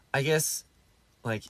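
usual speaking voice

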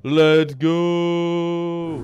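high, long-held "go"; a drawn-out rallying call to start; hype shout. Pretend. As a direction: stretch it out like an arena hype man. Caster launches the series.

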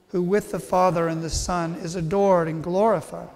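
ordinary delivery

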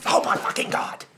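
loud, fed-up yell; exasperated outburst. Acted. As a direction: blow up at the bickering. Fed up with squabbling.

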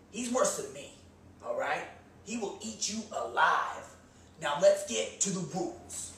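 ordinary talk

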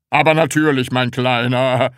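loud, hearty boss voice; jovial, laughing. A narrator's reading. Department head reassures the little Sams, jovial laughing. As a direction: say it with a patronising chuckle. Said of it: "sagte der Abteilungsleiter lachend".

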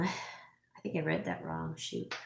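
slow whispered self-correction; whispered aside. Real, not acted. Muttering to herself after misreading a name, whispered. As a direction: murmur it to yourself, off mic.

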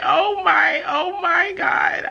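high, slow, crying shout of joy; tearful joy. Real, not acted. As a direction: let the tears come through. Overwhelmed by news.

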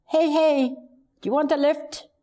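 high, bright call of a passing driver. Retold or imitated. Young woman offering a stranger a lift, calling out high. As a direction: hail him from the car window.

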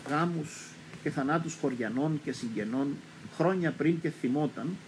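ordinary delivery

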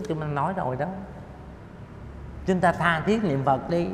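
normal talking voice

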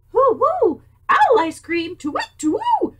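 high, loud hooting owl voice. Acted. Owl hooting to the little mouse. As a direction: hoot it out like a silly owl.

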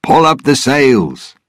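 high-pitched command to the crew; calling out an order. A narrator's reading. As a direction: call it across the deck to the sailors. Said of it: "Gibbs called to the other sailors".

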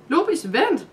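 high, loud call after the dog; shouting. A narrator's reading. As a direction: call out urgently for it to wait. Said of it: "råbte Maja". (højt råb efter hunden)

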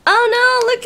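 high-pitched delighted dismay; happy "oh no". Real, not acted. Spotting a cute candy, high delighted "oh no". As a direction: squeal "oh no" as good news. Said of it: "that's a good oh no".

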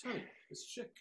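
a close take on Chick's phone greeting, quiet; imitating Chick. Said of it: "just like the way he says it".

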